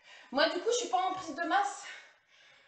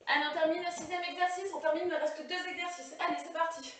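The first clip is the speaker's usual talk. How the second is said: normal talking voice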